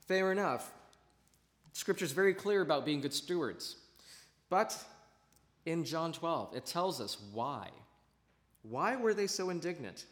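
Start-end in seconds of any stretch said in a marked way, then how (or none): none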